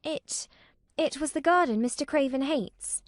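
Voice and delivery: tripping on the first word, a little nervous. A narrator's reading. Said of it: "said Mary nervously".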